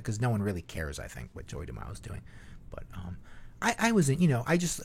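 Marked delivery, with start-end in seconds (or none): none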